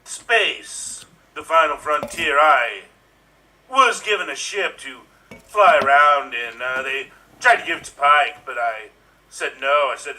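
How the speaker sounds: hammed-up starship captain, halting pauses; Star Trek captain. Improviser recreating Star Trek for the audience, hammed-up captain. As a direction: play a melodramatic captain in fits and starts. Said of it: "Please recreate Star Trek for us".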